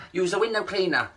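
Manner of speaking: ordinary talk, nothing marked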